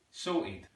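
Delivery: "Sorted" with the T dropped; East London pronunciation. Shown as a demonstration. Teacher demonstrating East London, "Sorted" without its T. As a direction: say "Sorted" as "sor'ed". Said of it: "Completely dropping the T there".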